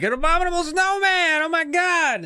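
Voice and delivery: put-on high, over-the-top gasp of a thrilled kid; mock excited squeal. Kid shrieks about the snowman in hammed-up excitement. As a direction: squeal it like you've spotted a monster. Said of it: "Yeah, he's like".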